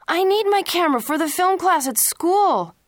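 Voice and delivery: high, loud cry of protest; upset protest. A narrator's reading. Son protesting to his parents, a high loud upset cry. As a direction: shout it out like a teen who can't believe it.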